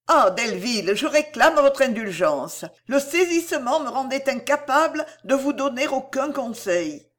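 a hint of a shaken young lady; contrite Cécile.

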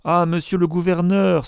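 high, pleading wail; distraught cry. A narrator's reading. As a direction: call out for rescue, undone. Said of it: "s'écria Isaac Acabut tout défait".

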